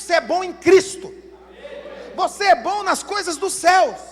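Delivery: loud, fervent preaching; rising pulpit fervour. Acted. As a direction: drive it home to the congregation. Preacher exhorting the congregation.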